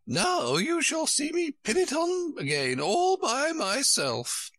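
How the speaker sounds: high, slow, proud Queen voice; self-satisfied high-voiced Queen; triumphant. A narrator's reading. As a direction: crow proudly in a high pitch. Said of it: "she cried in a triumphant tone".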